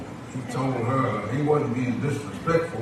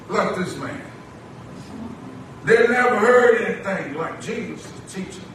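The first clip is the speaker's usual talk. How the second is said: measured, projected sermon cadence; short phrases with long pauses for the congregation; preaching; 9 semitones higher, 4 dB louder than usual. Acted. Preacher tells the church no one speaks like Jesus, preaching.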